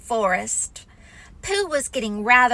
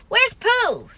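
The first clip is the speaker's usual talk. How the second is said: put-on higher, worried boy's call; Christopher Robin asking; 11 semitones higher, 4 dB louder than usual. A narrator's reading. Christopher Robin at the door, asking higher. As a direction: ask it as an anxious boy. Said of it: "he asked".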